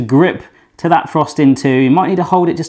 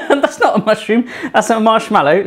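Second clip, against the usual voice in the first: higher, laughing self-correction; laughing at herself; 6 semitones higher than usual. Real, not acted. Baker catching her own word slip, laughing. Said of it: "Sorry, going bonkers today".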